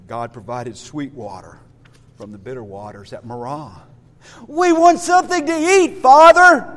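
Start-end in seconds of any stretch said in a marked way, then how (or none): 4.5-6.7 high, loud mock demand; complaining. Israelites demand food from God, complaining. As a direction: play childish grumblers, louder on the last word. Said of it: "they continue to complain and grumble".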